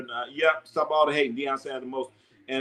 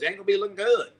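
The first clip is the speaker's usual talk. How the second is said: put-on country accent; 6 semitones higher, faster than usual. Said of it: "I gotta get my country accent".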